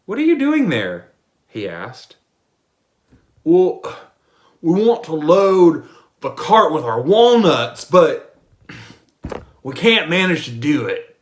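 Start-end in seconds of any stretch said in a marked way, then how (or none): 0.0-1.1 much higher, louder asking; voiced curious lover; high questioning voice. Lover asks the fools. 3.4-11.2 louder, higher simple fellow; hearty bumpkin voice; loud fool's voice. Acted. Fool answers the lover.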